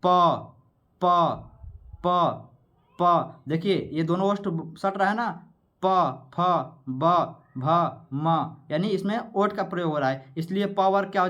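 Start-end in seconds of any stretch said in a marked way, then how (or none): 0.0-8.6 प pressed between the lips, one letter at a time; sounding out letters. Shown as a demonstration. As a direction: show both lips closing on प, फ, ब. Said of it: "इस तरह प बोलेंगे". (होंठ सटाकर प वर्ग बोलना)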